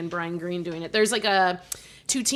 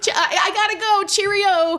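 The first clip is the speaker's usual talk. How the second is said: excited pun punchline; 13 semitones higher, 8 dB louder than usual. Acted. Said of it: "pun game demo".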